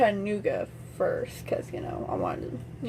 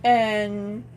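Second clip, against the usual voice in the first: ordinary talk, nothing marked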